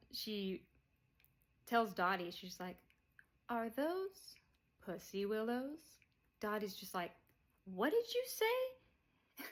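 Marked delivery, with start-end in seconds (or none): 3.5-5.8 lilting mock-innocent question, pitched up; innocent-sounding jab from Beverly; sly needling question. 7.7-9.4 much higher, slowed, incredulous squeal; high, slow offended gasp of a question; outraged disbelief. Retold or imitated. Dottie outraged at Beverly.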